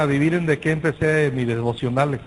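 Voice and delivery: ordinary delivery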